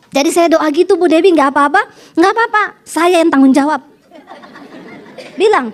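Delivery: normal talking voice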